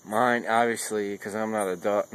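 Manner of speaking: ordinary delivery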